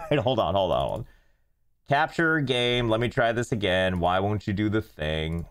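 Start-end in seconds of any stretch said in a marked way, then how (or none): none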